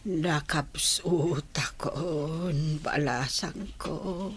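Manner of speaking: slow tearful words through crying; a dying mother's slow sobbing farewell; weeping. Acted. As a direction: weep as you tell her you are weak. Dying mother weeps to daughter.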